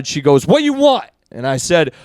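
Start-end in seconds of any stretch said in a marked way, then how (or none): 0.5-1.1 high-pitched imitation of a curt counter woman; brusque shop woman. Donut shop woman to a hesitant new customer, brusque. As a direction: mimic her abrupt demand, pitched way up. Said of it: "it was like a soup Nazi situation".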